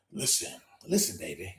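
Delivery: whispered, very low and soft; breathy low coo to a lover; hushed low seductive whisper; throaty whisper. Retold or imitated. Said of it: "when she's like".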